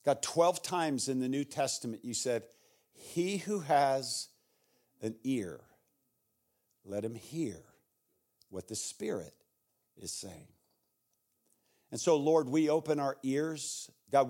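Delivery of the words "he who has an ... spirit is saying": hushed prayer. Real, not acted. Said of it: "prayer quoting scripture".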